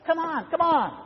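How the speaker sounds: a wife smiling back and coaxing her husband along. Retold or imitated. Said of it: "look behind with a smile".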